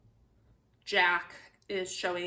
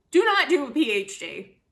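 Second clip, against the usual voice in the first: "not" hit hard, louder; emphatic warning; 5 semitones higher, 7 dB louder than usual. Real, not acted. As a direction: lean on "not" as a half-joking warning. Warning viewers against PhD.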